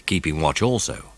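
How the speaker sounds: ordinary delivery, nothing marked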